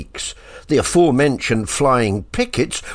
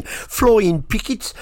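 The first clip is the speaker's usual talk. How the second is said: Birmingham accent; 6 semitones higher, slower than usual. Shown as a demonstration. Radio DJ repeating the band name in a Birmingham accent. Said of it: "my Birmingham accent came out there a bit".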